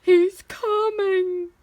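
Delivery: high, loud, drawn-out warning; alarmed cry. A narrator's reading. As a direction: wail the warning slowly in panic. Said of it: "cried the giantess".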